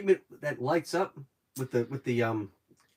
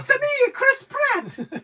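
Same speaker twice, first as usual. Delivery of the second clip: very high, loud cartoon plumber squeal; Mario; 6 dB louder, much slower than usual. Co-host spoofing the movie casting, squeaky mock cartoon voice. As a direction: shout it in a squeaky falsetto parody.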